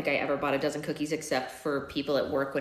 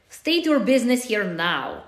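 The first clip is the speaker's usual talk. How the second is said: put-on Russian accent; 4 semitones higher, 6 dB louder, slower than usual. A narrator's reading. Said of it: "accent thick Russian".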